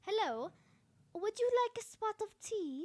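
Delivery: loud, lilting British accent. Shown as a demonstration. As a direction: put on a dainty British tea-time voice, projected. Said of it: "British accent".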